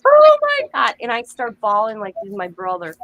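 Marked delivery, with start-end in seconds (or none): 0.0-0.9 high, loud gasp of disbelief; shocked "Oh my God", pitched way up and loud; retold shock. Mother reading online that Heath Ledger died, in high loud shock. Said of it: "and I'm like".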